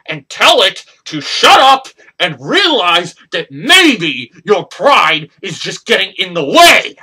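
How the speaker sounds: shouting down a rival in disbelief; furious rant. Acted. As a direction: yell it in his face, voice climbing. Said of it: "That's not what angers me".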